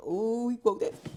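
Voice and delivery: high, loud laughing remark; real delighted laughter. Real, not acted. Reader laughs at the line.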